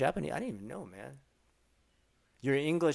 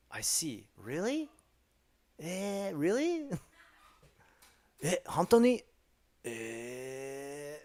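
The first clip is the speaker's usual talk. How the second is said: mock amazement; 3 semitones higher, 4 dB louder, much slower than usual. Streamer acting a fake listener: building mock amazement. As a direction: act more and more amazed. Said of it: "It's kinda like".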